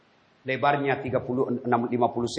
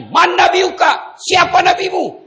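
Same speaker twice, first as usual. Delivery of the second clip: booming angel; 9 dB louder, slower than usual. Acted. Angel interrogating the dead.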